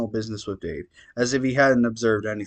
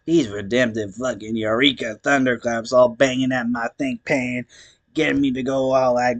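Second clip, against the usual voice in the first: stoned clown voice. Acted. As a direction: ramble on like a weepy clown. Gamzee monologue voice.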